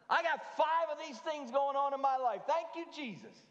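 high, loud sarcastic hallelujah; mock joyful praise. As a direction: play an over-the-top happy churchgoer.

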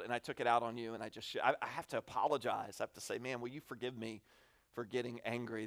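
put-on quietly apologizing to his kids after losing his cool; sincere apology. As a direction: say sorry gently after yelling. Dad asking kids for forgiveness.

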